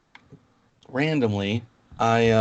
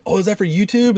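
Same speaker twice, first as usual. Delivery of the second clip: high, louder imitation of keen staff; mock eager interest; 9 semitones higher, 4 dB louder than usual. Cast member spotting a guest filming, imitated high excitement. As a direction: ask it like a thrilled park employee.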